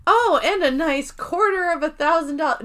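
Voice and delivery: high, pointed quote; Angela. Retold or imitated. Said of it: "I love how Angela says".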